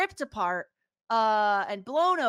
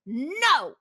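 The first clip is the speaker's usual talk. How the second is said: louder, higher mock-angry outburst; retold angry refusal; 4 semitones higher, 7 dB louder than usual. Retelling a woman turning down a movie request, mock-angry. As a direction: imitate someone pissed off, loud and emphatic. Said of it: "she's all pissed off".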